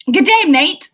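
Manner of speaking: mock Aussie greeting, loud; cheery mock Aussie hello; Australian accent. Shown as a demonstration. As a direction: try on a broad Australian accent. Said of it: "so that's something Australians say".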